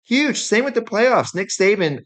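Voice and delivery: rising rant energy; worked up. Real, not acted. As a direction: stress the huge effect, getting heated. Ranting about a huge effect.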